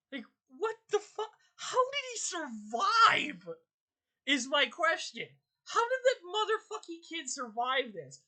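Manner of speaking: incredulous high-pitched rant; real outrage. Real, not acted. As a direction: rant at the screen, voice cracking. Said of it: "the more it pisses me off".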